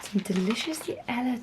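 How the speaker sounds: soft, hushed late-night chat; quiet nighttime voice. Real, not acted. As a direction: talk low so the house stays asleep.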